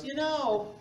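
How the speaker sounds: lightly voiced spinster teacher complaining; a hammed-up impression of a disappointed teacher; mimicking the teacher. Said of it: "She goes".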